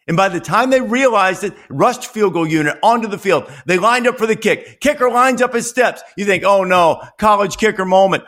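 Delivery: excited storyteller build-up; dramatic play-by-play. Acted. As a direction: call it like a late-game broadcast. Dramatic game recap.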